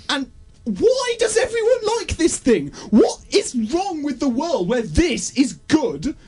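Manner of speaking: high, slow, wailing disbelief; exasperated outcry. Acted. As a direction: lament it with theatrical despair. Exasperated rant at film's popularity.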